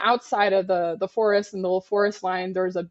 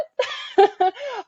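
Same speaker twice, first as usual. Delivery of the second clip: nervous laugh; 3 dB louder than usual. Real, not acted. Man threatens to kill her.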